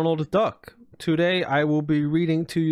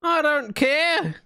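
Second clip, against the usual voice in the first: much higher, slow yelled retort; annoyed outburst; 10 semitones higher, slower than usual. Real, not acted. Streamer snapping at chat, high yelled. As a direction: throw it back at chat.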